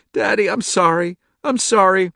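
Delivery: put-on high, loud, sobbing child; sobbing apology. A narrator's reading. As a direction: cry out sorry through tears.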